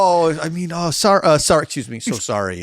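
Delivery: mock-flustered backpedalling, starting high; flustered apology. Street performer apologizing for swearing, flustered. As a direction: stumble over a hasty pretend apology.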